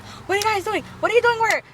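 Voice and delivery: high-pitched mock confrontation; retold indignant questioning. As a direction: act out a challenge, pitched well up. Confrontation acted out in retold show.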